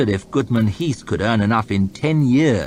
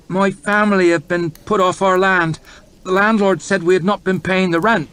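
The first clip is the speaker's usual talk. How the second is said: a lightly voiced poor farmer, louder; humble peasant plea; 11 semitones higher, 5 dB louder than usual. Poor farmer begging the lords for his land back. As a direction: plead your case humbly.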